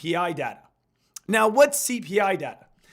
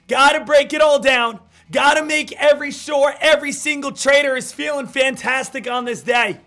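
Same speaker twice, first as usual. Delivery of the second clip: hype host; 4 semitones higher, 6 dB louder than usual. Acted. Pumped-up morning show opener.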